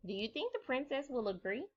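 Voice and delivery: normal talking voice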